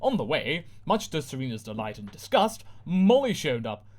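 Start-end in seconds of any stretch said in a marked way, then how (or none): none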